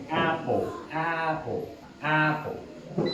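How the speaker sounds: apple with the mouth opened wide. Shown as a demonstration. Teacher modelling apple with the jaw dropped open. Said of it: "el sonido de una forma correcta".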